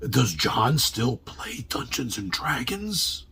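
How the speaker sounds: low, slow, hushed question; lightly voiced concerned relative. Relative asks parents about John's gaming, lightly acted hushed concern. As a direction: ask softly, as if treading carefully.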